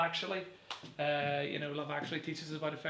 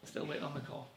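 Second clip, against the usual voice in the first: softer, wistful echo; quiet echo; 8 dB quieter than usual. Acted. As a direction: let it drop to a murmur. Repeated, much softer.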